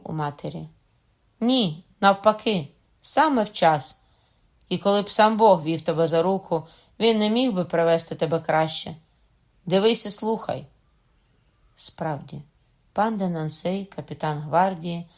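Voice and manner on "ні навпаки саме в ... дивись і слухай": loud, forceful, assured reply; emphatic declaration. A narrator's reading. Mother reassures returning son, emphatic declaration. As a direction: proclaim it with ringing conviction. Queen triumphantly answers her son.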